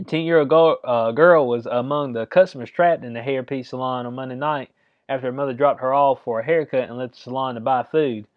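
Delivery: normal talking voice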